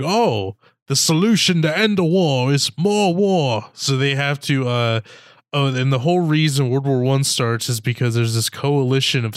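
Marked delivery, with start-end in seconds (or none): 0.0-3.7 singsong, higher put-on character voice; mock simpleton. Host imitating the movie spies' war logic, mock simpleton. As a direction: parody their logic as if it were a bright idea.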